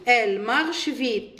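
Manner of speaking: very slow, each French word laid out clearly; slow model reading. Shown as a demonstration. Teacher reads the example sentence to pupils, slowly. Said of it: "إذن لدينا مثال".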